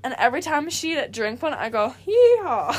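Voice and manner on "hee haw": laughing mock hee-haw, high and loud; donkey bray. Teasing the Mountain Dew girl with a laughing donkey bray.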